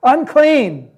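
rising to a loud, high cry of Unclean; leper's shout. Retold or imitated. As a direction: shout it out to warn the crowd. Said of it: "you gotta shout".

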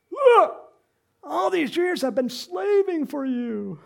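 high, loud, drawn-out complaint; hammed-up furious grievance; angry yell. Older son yells at his father, angry. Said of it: "You never yell at your dad".

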